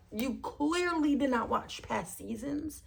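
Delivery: ordinary delivery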